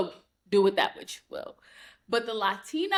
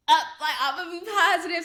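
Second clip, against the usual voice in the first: higher, quick burst of positivity; upbeat resolve; 8 semitones higher, faster than usual. Retold or imitated. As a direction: retell a sudden pep talk to yourself. Retelling her switch to positivity.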